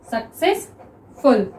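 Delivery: Successful stretched slowly, syllable by syllable; slow drawn-out reading. Shown as a demonstration. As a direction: read successful very slowly for the class. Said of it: "next देखिए successful". (Successful को धीरे-धीरे खींचकर पढ़ना)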